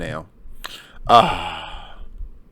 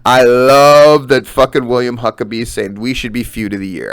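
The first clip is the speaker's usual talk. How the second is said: ordinary talk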